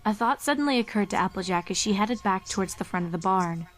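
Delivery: usual speaking voice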